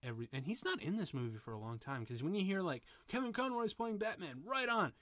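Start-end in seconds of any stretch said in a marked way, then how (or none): 3.1-4.9 lightly voiced thrilled fan; excited fan. Host voicing reaction to Conroy's casting, excited.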